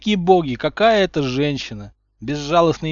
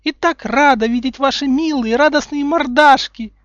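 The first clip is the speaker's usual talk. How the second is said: put-on much higher and louder, sugary little-girl voice; girlish high voice; 13 semitones higher, 10 dB louder than usual. A narrator's reading. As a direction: chirp it like a sweet girl. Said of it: "высоким девичьим голоском". (высоким девичьим голоском)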